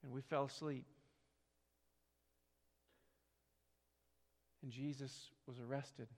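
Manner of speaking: quiet reflective sermon narration; hushed preaching. Acted. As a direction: draw them in with a hushed solemn voice. Quiet solemn sermon storytelling.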